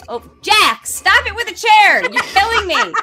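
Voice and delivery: high, loud exasperated plea; exasperated scolding. Real, not acted. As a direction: beg him to stop, fed up. Scolding Jack about squeaky chair.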